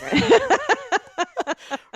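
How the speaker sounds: loud high laugh at the joke; burst of laughter. Real, not acted. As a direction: laugh out loud, caught off guard. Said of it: "Rude, rude, rude".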